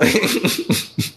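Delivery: breathless unvoiced laugh, high; a quick breathy chuckle; airy laugh. Real, not acted. Streamer laughs off viewers calling him a god, breathy laugh.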